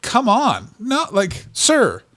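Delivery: high, incredulous retold protest; exasperated refusal.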